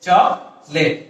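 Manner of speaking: chocolate broken as "chok", pause, "let"; slow two-part chocolate with no middle o; correct pronunciation. Shown as a demonstration. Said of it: "O will be dropped from here". (শুদ্ধ উচ্চারণে chocolate, ভেঙে "চক... লেট")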